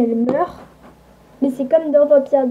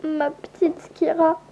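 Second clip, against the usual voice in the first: sanglote puis éclate plus fort; aveu en pleurs; 4 semitones higher, 5 dB louder than usual. Acted. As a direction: avoue la morsure en pleurant. Aveu en pleurs qui s'emporte. (pleurs qui montent en cri)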